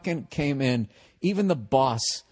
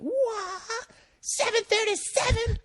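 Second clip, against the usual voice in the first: shrill high scream of the question, much higher; loud screamed question; slower than usual. Retold or imitated. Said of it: "As loud as he could".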